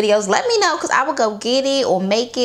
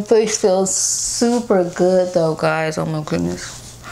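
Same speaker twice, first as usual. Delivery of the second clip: speaking through cupped hands; intermittently covered mouth; muffled behind hands; 2 semitones lower, slower than usual. Real, not acted. Seen 3: Hands pat and massage face, repeatedly covering her mouth while speaking.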